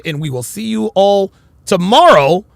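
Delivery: ordinary delivery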